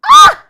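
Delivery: sudden high scream of shock; startled scream. Real, not acted. Shrieking at Shay's question, startled scream.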